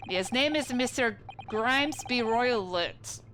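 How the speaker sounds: put-on proud, broken English with a Russian accent; Russian sailor accent. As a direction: announce your name like a stern Russian crewman. Sailor names passenger.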